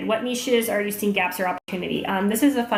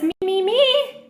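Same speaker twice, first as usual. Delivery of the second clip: eager mock voice; 11 semitones higher, 4 dB louder, slower than usual. Reading eager commenter's reply.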